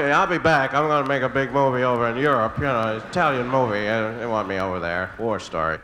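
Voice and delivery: boasting like a has-been actor, the voice sinking; washed-up dog star. Retold or imitated. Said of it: "he's telling me".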